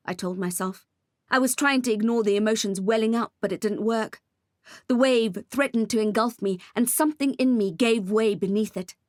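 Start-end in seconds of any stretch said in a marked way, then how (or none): none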